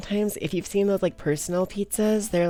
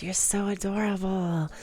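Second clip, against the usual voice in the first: ordinary talk, nothing marked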